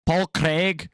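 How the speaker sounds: tentative stab at a Scottish accent; attempted Scottish accent. Shown as a demonstration. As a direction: try the name with a Scottish lilt. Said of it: "I can't really do a Scottish accent".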